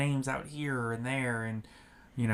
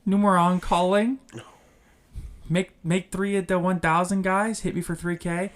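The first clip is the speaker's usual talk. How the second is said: incredulous; 7 semitones higher, 5 dB louder than usual. Retold or imitated. Incredulous retelling of opponent's plays.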